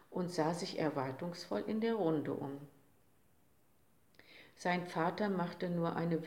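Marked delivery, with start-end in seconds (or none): none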